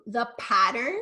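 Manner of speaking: usual speaking voice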